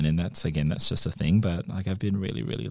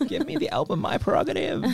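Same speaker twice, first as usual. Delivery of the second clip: put-on giggly little kid. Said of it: "being a young kid".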